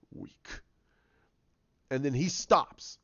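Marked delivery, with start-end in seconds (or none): 0.1-0.5 very soft villain jab; quiet taunt. Retold or imitated. Villain dismissing Rey's strength, very quiet contempt. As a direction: say it under the breath as a villain.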